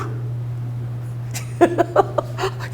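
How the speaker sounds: unvoiced wheezing laugh; breathy laugh. Real, not acted. Preacher laughing at his own story, airy wheeze. After joke about God.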